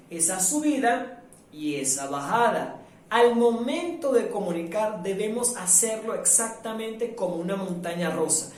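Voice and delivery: usual speaking voice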